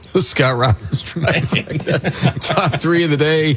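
slow announcement broken up by laughter; laughing through the words. Real, not acted. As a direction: try to announce it while cracking up. Cracking up while reading the bumper.

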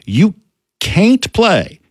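can't stretched into caint, high and drawn out; mimicking a South Georgia coach's caint; drawled caint. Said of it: "I guess you would spell that C A I N T".

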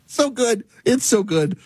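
high, amused through laughter; real laughter, fading out; laughing. Real, not acted. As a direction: still laughing as you say it. Laughing after the joke.

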